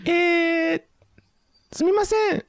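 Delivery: very high, loud falsetto apology; squeaky falsetto. Pretend. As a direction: squeal it like a cartoon. Mock phone call, high squeak.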